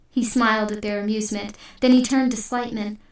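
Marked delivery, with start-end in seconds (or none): none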